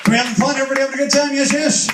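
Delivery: loud, high-pitched shout to the crowd; rousing crowd call. Real, not acted. Show host asks everybody about a good time, rousing shout. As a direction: whip up the whole room, loud and high.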